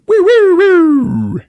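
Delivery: high, loud wailing siren imitation; mimicked siren wail; police siren. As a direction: whoop like a police car. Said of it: "That's the sound of the police".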